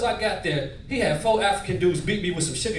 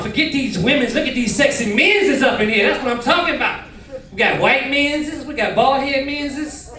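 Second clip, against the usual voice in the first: higher camp enthusiasm; hammed-up camp voice; 6 semitones higher, 4 dB louder than usual. Hypnotized man admiring men, hammed-up camp voice. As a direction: play it camp, pitched up and showy.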